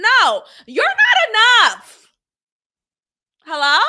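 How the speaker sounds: high, loud shout of no, you're not enough; defiant shout. Retold or imitated. To the ex who called her too much, defiant shout. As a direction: yell it back, finally standing up. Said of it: "it just struck me like".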